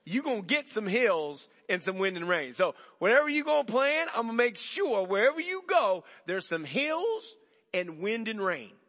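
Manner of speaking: loud, high, emphatic warning; firm insistence. Retold or imitated. Jesus telling the stubborn runner there will be hills, loud. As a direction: lay down the law, loud.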